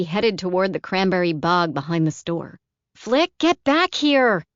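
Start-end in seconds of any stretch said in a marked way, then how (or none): 3.0-4.4 put-on high, loud, slow yell after a child. A narrator's reading. Caroline yelling after Flick in the mist, loud yell. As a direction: shout him back as he runs off. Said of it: "Caroline yelled".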